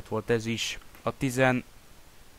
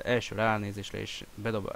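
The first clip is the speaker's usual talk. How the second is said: usual speaking voice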